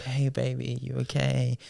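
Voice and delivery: soft, cooing sweet talk; sweet boyfriend talk. Retold or imitated. Retelling a call with his girlfriend, soft sweet talk. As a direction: coo it gently down the phone. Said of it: "And I was like".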